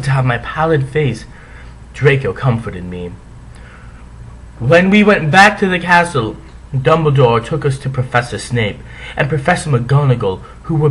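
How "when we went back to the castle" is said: plain reading voice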